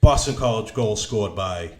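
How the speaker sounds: low, flat away-goal call; toned-down announcer. Shown as a demonstration. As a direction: read it out without excitement. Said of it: "you tone it down when it's the other team's goal".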